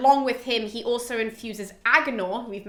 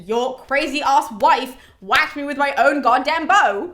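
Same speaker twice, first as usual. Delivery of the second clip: louder, slowed-down aggrieved tattling; mock tearful complaint; 3 semitones higher, 7 dB louder, slower than usual. Artemis complains to Zeus about his wife, mock tearful outrage. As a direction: act out a hammed-up, wounded protest. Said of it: "who is now crying to Zeus".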